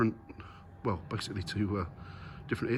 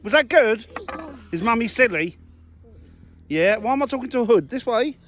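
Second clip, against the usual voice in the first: playful mum voice; 12 semitones higher, 7 dB louder than usual. Real, not acted. Mum clowning around with her little boy, high and playful. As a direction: coo and tease him like a silly mummy. Mum playing with toddler, being silly.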